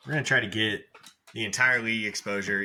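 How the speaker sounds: ordinary talk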